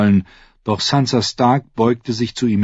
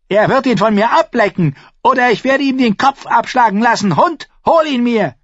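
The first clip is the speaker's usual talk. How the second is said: high, furious threat; commanding shout; 4 dB louder than usual. A narrator's reading. King threatening the culprit and ordering his guard, shouting. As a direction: yell the threat, then order the henchman. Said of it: "befahl Joffrey".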